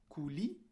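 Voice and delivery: "couli" with an I after the L; wrong added vowel. Shown as a demonstration. Teacher showing what was not said, added final I. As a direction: add an audible I to end "couli". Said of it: "j'ai pas dit".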